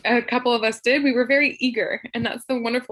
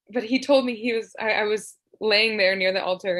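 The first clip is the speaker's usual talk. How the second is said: ordinary talk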